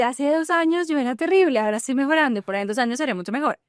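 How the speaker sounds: upbeat self-talk. Retold or imitated. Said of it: "eso me mantiene fiel a decir".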